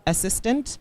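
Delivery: ordinary delivery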